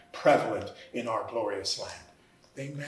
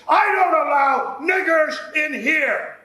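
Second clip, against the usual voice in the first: a slow, much higher, furious shout; fiendish haste; 14 semitones higher, 11 dB louder, slower than usual. Retold or imitated. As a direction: bellow it out like a hateful driver. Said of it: "the fiendish haste".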